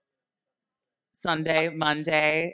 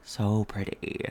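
slow low so pretty; 5 semitones lower, much slower than usual. Real, not acted. Echoing compliment.

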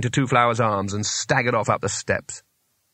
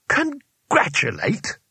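much higher, slow outraged echo of the word; exploding in disbelief; 8 semitones higher, much slower than usual. Acted. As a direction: spit the word back in disbelief. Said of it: "exploded Rincewind".

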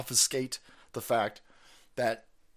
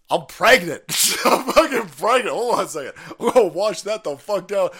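laughing; 4 semitones higher, 8 dB louder than usual. Real, not acted. Laughing at the statement.

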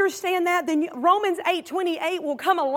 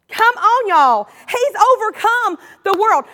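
ordinary delivery

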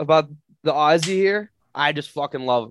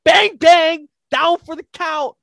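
much higher and louder, yelling with laughter; hyped shouting; 8 dB louder, slower than usual. Real, not acted. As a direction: scream it like you're cageside cheering. Hyped fan cheers on his fighter.

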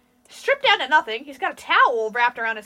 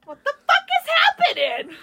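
high-pitched, incredulous yell; mock alarmed outcry; 12 semitones higher than usual. Coworkers joking about the crazy attendant, mock alarm. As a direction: cry out in mock panic.

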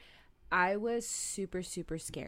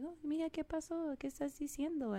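higher, lightly voiced grandmother asking; a puzzled abuela questioning her granddaughter; a hint of a puzzled grandma; grandma in Spanish; 9 semitones higher, faster than usual. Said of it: "Of course, in Spanish".

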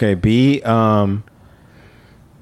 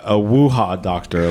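ordinary delivery

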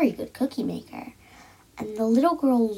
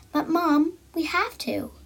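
put-on higher, begging little girl; pleading; 6 semitones higher than usual. A narrator's reading. Girl pleading with mother, higher. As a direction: plead with mom not to cancel. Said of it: "the little girl pleaded".

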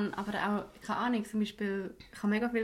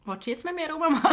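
ordinary talk, nothing marked

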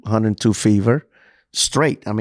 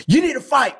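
much higher, yelled retelling of the doctor; retold yell. ER doctor yelling at the sick patient to fight. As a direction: shout it like the doctor did, urging him on. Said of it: "He started yelling at me".

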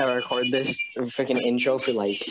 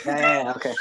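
high laughing yeahs; squealing with laughter; 4 dB louder than usual. Real, not acted. Laughing high after friend's rant.

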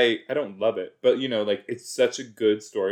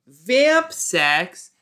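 higher, louder disgusted question; 8 semitones higher, 7 dB louder than usual. Real, not acted. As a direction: squeal it in disgust. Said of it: "That's gross".

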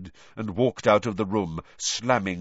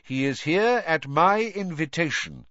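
higher, firm assertion; raised-pitch voice of command; authoritative headmaster; 8 semitones higher, 4 dB louder than usual. Acted. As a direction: step in with full authority.

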